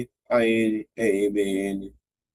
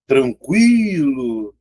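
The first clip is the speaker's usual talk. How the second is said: TRANQUILO very drawn out, swelling on the I after a short U glide; stronger I; 4 dB louder, much slower than usual. Shown as a demonstration. Teacher shows the I is stronger, long stressed I in TRANQUILO. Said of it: "Veja que o I é mais forte".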